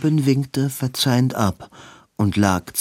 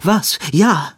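high, louder, eager outburst; eager agreement; 7 semitones higher, 4 dB louder than usual. A narrator's reading. Robin agrees at once to Edwin's idea, high and eager. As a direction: blurt it out high and keen.

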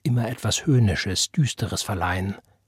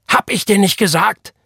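high, loud enraged father; furious shout; 11 semitones higher, 7 dB louder than usual. A narrator's reading. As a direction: yell in frightening rage. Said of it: "schrie sein Vater mit beängstigender Wut". (wütend schreien)

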